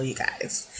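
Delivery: breathy whisper to the viewers; whispered aside. Real, not acted. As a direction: drop to a whisper for the sign-off. Soft sign-off aside to viewers.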